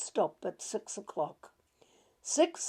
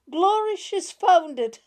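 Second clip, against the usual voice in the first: much higher, louder joyful cry; excited exclamation; 9 semitones higher, 11 dB louder than usual. Retold or imitated. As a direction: burst out in relief. Uncle sees her find something.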